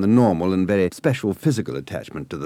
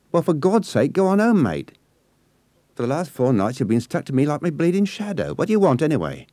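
put-on exasperated Cockney girl; 7 semitones higher than usual. A narrator's reading. Nessie tells the boy to go home, exasperated. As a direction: shoo him off, fed up with him.

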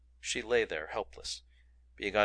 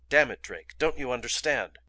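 angry outburst; 4 semitones higher, 6 dB louder than usual. A narrator's reading. Furious at Drake.

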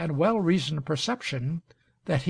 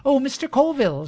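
quick, high-pitched, louder call; 10 semitones higher, 6 dB louder, faster than usual. A narrator's reading. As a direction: call after him before he leaves. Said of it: "she called".

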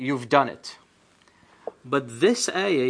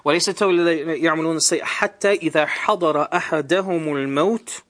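fast melodic Quranic recitation; تلاوة القرآن بسرعة; 3 semitones higher, 4 dB louder, much faster than usual. Acted. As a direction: recite the verse in Arabic, fast and flowing.

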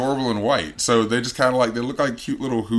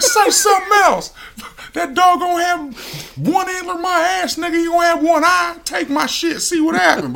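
mock nineties gangster. Who daring the Grinch to steal, high mock gangster threat. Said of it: "sound like fucking nineties gangsters".

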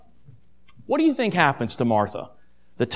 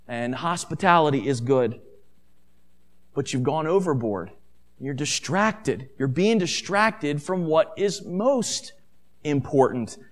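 tender yet direct; 5 semitones lower, 7 dB quieter than usual. Acted. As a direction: correct her gently and softly, with measured pauses. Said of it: "but he does speak tenderly, yet directly".

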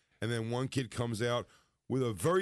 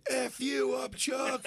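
mock crowd yelling, much higher; 14 semitones higher, slower than usual. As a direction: hurl it like a rowdy crowd. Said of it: "they're like".

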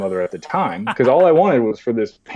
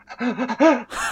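imitated gasping sob, high; bursting into tears. Boy hearing his mom's voice on the phone, gasping sob. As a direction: gasp for air as the tears come. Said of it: "as I burst into tears".

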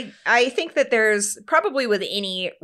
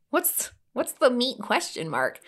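innocent question; 4 semitones higher, 4 dB quieter than usual. Retold or imitated. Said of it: "I'm all".

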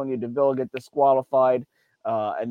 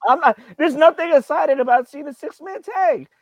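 heated rant; 7 dB louder than usual. Real, not acted. Ranting about a six-man tag.

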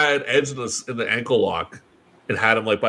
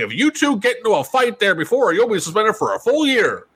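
mock boss warning; 9 semitones higher than usual. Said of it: "somebody's like".

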